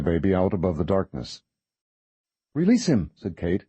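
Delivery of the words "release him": firm demand. Acted. Kate repeating her demand.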